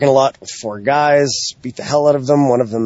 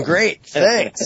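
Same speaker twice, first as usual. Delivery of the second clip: ordinary delivery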